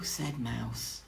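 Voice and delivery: ordinary delivery, nothing marked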